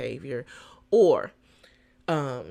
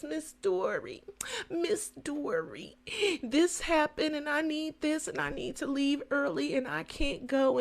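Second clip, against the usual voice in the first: pleading employees; 8 semitones higher than usual. Retold or imitated.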